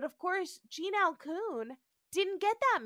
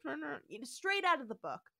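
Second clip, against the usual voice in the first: usual speaking voice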